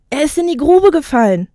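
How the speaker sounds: high, urgent cry from the crowd; calling out. Acted. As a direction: shout the alarm over the crowd. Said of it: "rief einer".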